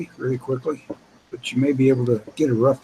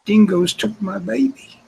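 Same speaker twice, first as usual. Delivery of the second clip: movie quote voice; 8 semitones higher than usual. Retold or imitated. Quoting famous movie line.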